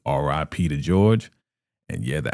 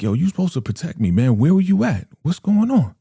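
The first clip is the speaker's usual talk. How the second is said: high, loud mock outrage; indignant protest; 12 semitones higher, 5 dB louder than usual. Ruth asking why nobody protected her, indignant. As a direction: protest in a rising, incredulous pitch. Retelling Ruth's complaint to Manny.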